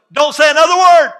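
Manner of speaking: high, very loud command; scolding parent. Acted. Parent silencing a child who answers back, shouting.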